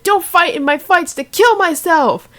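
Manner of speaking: high, loud furious yell; angry shout. Acted. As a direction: scream it while attacking. Sayaka attacks Homura.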